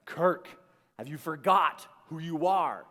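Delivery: loud, commanding challenge; forceful inner voice. Retold or imitated. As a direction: call him out firmly, name first, then the question.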